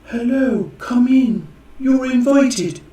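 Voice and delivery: high, sing-song mock welcome; welcoming accent mark. Explaining an open accent mark as a host, mock-welcoming. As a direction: call out a playful high-pitched invitation. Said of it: "it's like".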